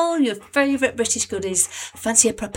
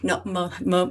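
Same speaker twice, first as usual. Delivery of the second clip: usual speaking voice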